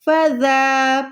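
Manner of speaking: फ़ज़ा with soft "dh" and held long aa; drawn-out फ़ज़ा; soft zaal. Shown as a demonstration. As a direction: recite फ़ज़ा slowly as "fa-dhaaa". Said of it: "जाल पढ़ रही हूँ".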